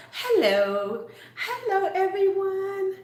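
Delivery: high, slow sing-song hello; mock sweet video voice, rising high; imitating a YouTuber greeting. Mocking how Angela greets viewers, high sugary hello. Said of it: "she's so".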